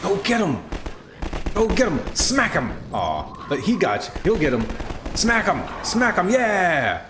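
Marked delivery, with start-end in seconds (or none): 0.0-2.7 high-pitched rooting yells; excited cheering. Real, not acted. Cheering on antlions in fight. 5.1-7.1 high-pitched rooting yells; excited cheering. Real, not acted. Cheering on antlions in fight.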